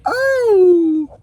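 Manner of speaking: high yelp of pain into a laugh; pained high cry. Real, not acted. Hurt after the burn.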